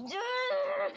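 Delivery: high, loud squeal of acted fury; mock angry cry. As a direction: imitate a high-pitched attack cry. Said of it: "she just gets all mad at him".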